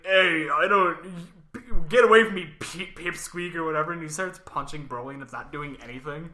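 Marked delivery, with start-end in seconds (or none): 0.1-3.5 put-on loud drunk shouting; drunk yell. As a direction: shove him off with a yell. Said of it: "this other guy's like".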